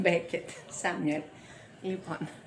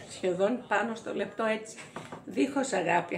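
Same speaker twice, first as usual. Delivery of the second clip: usual speaking voice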